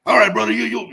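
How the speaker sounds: put-on loud, high Samoan accent. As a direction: boom it out in a thick Samoan accent. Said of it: "that thick Samoan accent".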